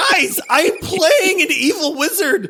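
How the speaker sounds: high, laughing, exasperated protest; a laughing squeal of protest to friends; laughing outburst. Retold or imitated. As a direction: cry out with a laugh that you can't do it. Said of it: "And I was like".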